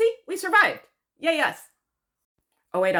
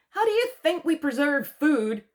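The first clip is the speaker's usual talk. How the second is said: higher-pitched, incredulous telling-off; indignant, raised-pitch rhetorical question; mock exasperated scolding; 6 semitones higher, 4 dB louder than usual. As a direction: scold an imagined dunce. Mock-scolding her ignorance of salt curing.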